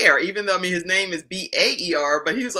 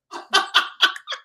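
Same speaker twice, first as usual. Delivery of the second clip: laughing. Real, not acted. Host laughs at stalker line.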